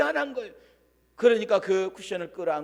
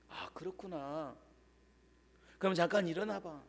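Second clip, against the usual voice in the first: gentle reply; 10 dB quieter than usual. Retold or imitated. Professor gently responds.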